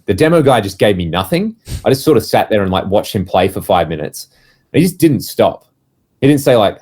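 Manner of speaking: ordinary delivery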